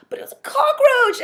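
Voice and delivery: whiny protest. Retold or imitated. Defending herself, near tears.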